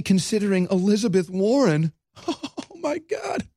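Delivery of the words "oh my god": laughing. Real, not acted. Laughing at Warren news.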